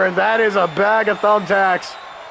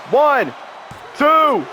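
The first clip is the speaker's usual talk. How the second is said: shouted, high count; excited pin count; 8 semitones higher than usual. Real, not acted.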